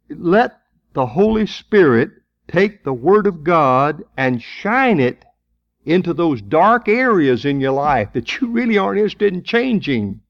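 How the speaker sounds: usual speaking voice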